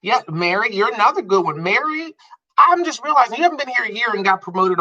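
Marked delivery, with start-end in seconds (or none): none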